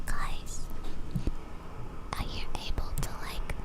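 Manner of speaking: whispered to chat; whispering. Real, not acted. Asking chat about the mower noise, whispered. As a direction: hush it like someone could hear. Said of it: "Why am I whispering?".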